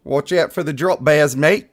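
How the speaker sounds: put-on a Brit hamming up an Australian accent; lightly put-on Aussie accent, playful; jokey Australian accent warning about drop bears; mock Australian accent. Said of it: "I sound like a Brit trying to be Aussie".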